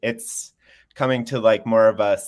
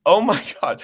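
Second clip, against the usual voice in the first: giggling through oh my God; laughing oh my God; 6 semitones higher, 6 dB louder than usual. Retold or imitated. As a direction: break into a laugh mid-phrase. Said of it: "I was like".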